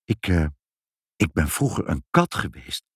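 embarrassed, halting mother; bashful confession. A narrator's reading. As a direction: confess it shyly after a false start. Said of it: "zei moeder blozend".

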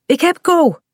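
high-pitched, relieved exclamation; relieved call. A narrator's reading. Kaat holds her dog at last, calling out relieved. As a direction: let relief lift the voice. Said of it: "roept ze dan opgelucht".

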